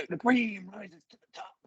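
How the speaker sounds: high-pitched wrestler promo voice; Macho Man. Acted. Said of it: "Macho Man".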